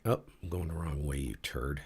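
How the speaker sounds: usual speaking voice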